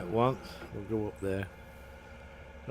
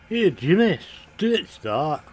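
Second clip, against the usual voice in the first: loud, higher-pitched Glaswegian; Glaswegian accent; 5 semitones higher, 7 dB louder than usual. Shown as a demonstration. Player showing off an accent he can do, loud Glaswegian. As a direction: call out in broad Glaswegian. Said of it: "I can do a Glaswegian accent".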